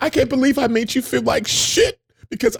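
high, mock-tearful wail; hammed-up weepy confession; imitated crying. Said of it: "she was crying like".

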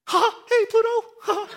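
very high, loud cartoon mouse squeak; Mickey Mouse. Pastor imagining Jacob's voice, as a Mickey Mouse impression. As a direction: squeal it in falsetto with a giggly laugh. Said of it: "Jacob sounds like Mickey Mouse".

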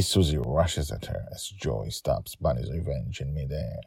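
ordinary delivery

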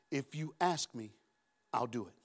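quietly voicing Jesus' promise; soft Jesus voice. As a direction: speak it as a patient gentleman. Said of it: "His protocol is".

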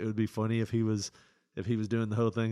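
usual speaking voice

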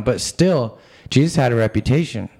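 ordinary talk, nothing marked

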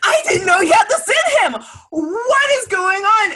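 high, loud freaking-out shout; excited shout. Retold or imitated. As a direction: yell it in total disbelief. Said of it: "I was like".

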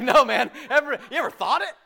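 quick, high, laughing through it; laughing quote. Retold or imitated. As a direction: laugh while tossing back the line.

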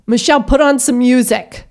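loud, high demanding call; a put-on bratty demand; bossy demand. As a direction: order the teacher about. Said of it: "says to Michelle".

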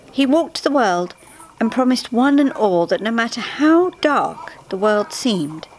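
plain reading voice

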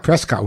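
Prescott with a clear "cot" at the end; mispronounced Prescott. Retold or imitated. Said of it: "It's Prescott".

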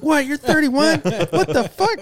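high, loud shocked squeal mimicking the girls; imitated shriek of disbelief; freaking out. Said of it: "they start freaking out".

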